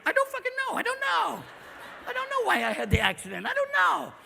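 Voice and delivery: high-pitched frantic protest; mock panic, voice leaping up high. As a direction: squeal it in exasperated confusion. Panicked answer about an accident.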